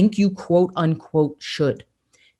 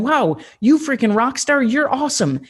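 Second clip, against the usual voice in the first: higher, bright hype-man cheer; cheering inner voice; 6 semitones higher than usual. Retold or imitated. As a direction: cheer yourself on like a fan. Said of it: "they're going".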